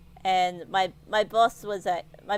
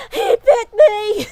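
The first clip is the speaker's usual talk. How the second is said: high, loud, slow crying yell; panicked shout; 13 semitones higher, 12 dB louder, slower than usual. Retold or imitated. Calling the boss on the phone, high crying shout. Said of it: "I'm like".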